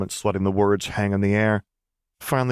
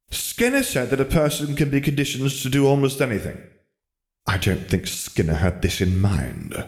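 exasperated wizard; 3 semitones higher than usual. Acted.

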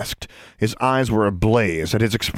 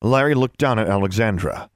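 plain reading voice

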